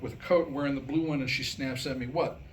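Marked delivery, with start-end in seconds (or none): none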